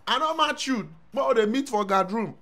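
high, loud hammed-up outburst; acted climax of the quarrel; mock yell.

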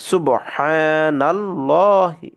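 সুবহানাল্লাহি drawn out very slowly with a thick lam; heavy lam recitation. Shown as a demonstration. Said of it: "মোটা করে পড়বো".

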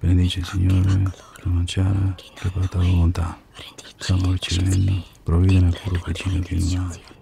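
grace in Italian. Acted. Son saying grace at his mother's table, murmured prayer.

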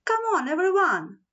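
high, quick call to everyone; rally passengers with a mild mock shout; brisk calling-out, lightly acted; storybook shout. A narrator's reading. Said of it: "the train shouted".

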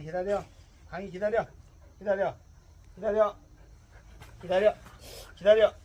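loud, sharp wait command; firm dog commands. Real, not acted.

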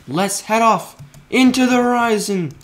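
high, loud rallying call; excited proclamation. Real, not acted. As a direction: announce the journey with gusto. Grand send-off for the journey.

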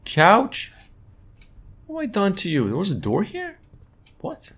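high, baffled questions; surprised. Real, not acted. Streamer confused by the changed room, surprised questions. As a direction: sound thrown by what changed.

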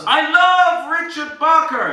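slow, high, drawn-out yell; top-of-lungs shout. Shown as a demonstration. Hypnotist modelling the volunteer's declaration, shouted at full volume. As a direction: shout it out, stretching the words. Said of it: "shout at the top of your lungs".

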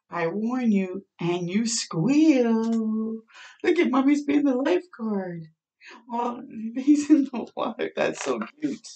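usual speaking voice

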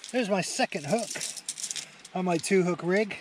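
loud, thrilled shouting at the catch; excited. Real, not acted. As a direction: let it burst out, beaming at the camera.

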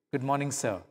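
soft, polite, peaceful greeting; gentle calm. Acted. Second miler calmly greeting the Roman soldier, soft and gentle. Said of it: "with a gentle calmness of spirit".

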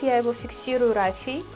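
normal talking voice

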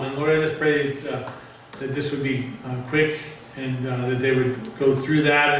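earnest spoken prayer; praying. Acted. Pastor leads congregational prayer.

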